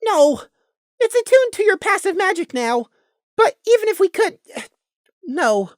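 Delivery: high voice straining with regret; high, hurting character voice; Prey's voice, regretful. A narrator's reading. Prey answers in story.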